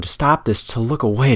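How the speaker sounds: usual speaking voice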